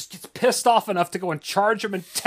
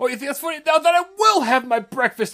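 higher put-on voice; mock threat; 9 semitones higher, 6 dB louder than usual. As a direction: echo the little guy's taunt. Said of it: "repeats the clip line".

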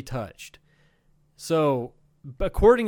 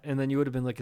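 usual speaking voice

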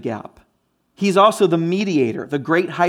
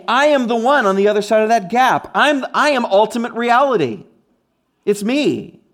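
higher-pitched, emphatic claim; bold declaration; 6 semitones higher, 4 dB louder than usual. Acted. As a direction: declare it boldly as the one true answer. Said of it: "Jesus is boldly saying".

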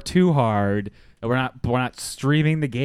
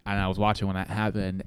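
usual speaking voice

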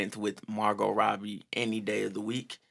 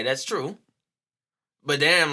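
ordinary delivery

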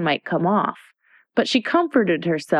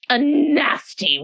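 haughty Red Queen; 9 semitones higher, slower than usual. Acted.

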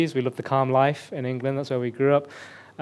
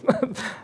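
short laugh; 11 semitones higher than usual. Real, not acted. Laughs at own wrong guess.

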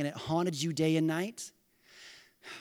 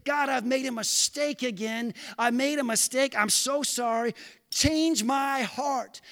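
louder, higher pleading prayer; heartfelt prayer; 6 semitones higher, 3 dB louder than usual. Shown as a demonstration. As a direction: pray it as a sincere confession. Models a repentant prayer.

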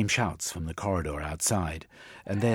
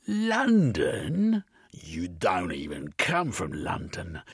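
scornful inspector; 2 semitones higher than usual. Acted. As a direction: spit out his contempt for her.

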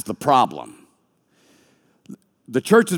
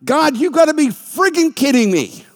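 high, loud disbelieving cry; exasperated outburst; 12 semitones higher, 4 dB louder than usual. Retold or imitated. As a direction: cry out in disbelief, much higher. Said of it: "And I'm just like".

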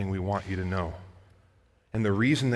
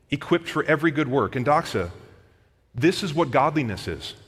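raised, emphatic pulpit voice; forceful preaching; 5 semitones higher, 7 dB louder than usual. Acted. Preacher telling the church the Bible is profitable, forceful. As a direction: press the point on the congregation, louder and higher.

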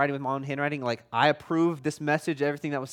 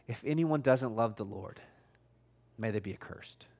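low, dropping to a whisper; a grave, whispered warning at the letter's end; hushed curse; 7 semitones lower, 7 dB quieter than usual. Retold or imitated. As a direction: let the curse sink into a whisper. Preacher voicing Paul's final curse.

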